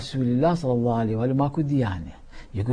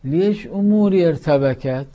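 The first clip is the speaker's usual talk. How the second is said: ordinary talk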